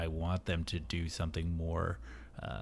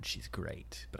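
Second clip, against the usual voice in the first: hushed unvoiced whisper; 3 semitones lower, 9 dB quieter than usual. Real, not acted. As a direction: whisper it like a secret. Sudden dropped to whisper.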